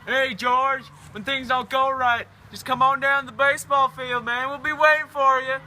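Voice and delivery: high, loud jeering call across a crowd; rowdy shout. Acted. Ballplayers hollering at George on his wedding day, loud and jeering. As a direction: yell it out to rib the groom.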